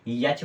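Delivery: यच with a y-glide, "yach"; children's mispronunciation यच. Shown as a demonstration. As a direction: misread H as यच. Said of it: "क्या पढ़ देते हैं".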